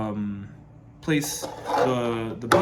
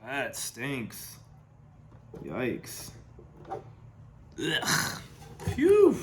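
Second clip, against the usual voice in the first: smelling something awful; much slower than usual. Real, not acted. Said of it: "'cause this smells horrible".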